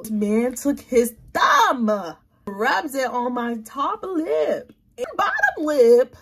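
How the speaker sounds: slow, loud, high and emphatic, half crying; shocked outrage. Real, not acted. Telling viewers the shocking thing her date did, slow and loud. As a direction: stretch every word out in disbelief.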